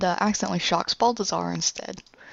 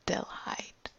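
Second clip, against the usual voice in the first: whispering; 10 dB quieter than usual. Retold or imitated. Possessed father whispers warning.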